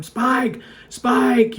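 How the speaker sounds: yelling the dog's name up the hill; calling out. Real, not acted. Owner yelling after his escaping dog, loud calls. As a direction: call out loud to a dog running away.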